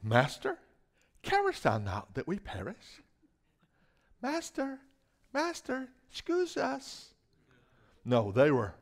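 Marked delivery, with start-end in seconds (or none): 0.0-3.0 soft, meek King James plea; mock-timid disciples asking quietly; timid formal plea. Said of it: "They didn't say". 4.2-7.1 much higher and much softer, slow and dainty; dainty, hushed mock disciples begging; mock meek plea. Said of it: "They didn't say".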